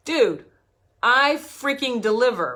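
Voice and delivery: loud, exasperated protest; annoyed coach insisting she delivers; losing it. Retold or imitated. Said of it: "So I'm losing my shit".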